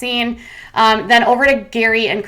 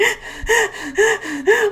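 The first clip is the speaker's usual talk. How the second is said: high giggle; 13 semitones higher than usual. Real, not acted. Laughs mid parody.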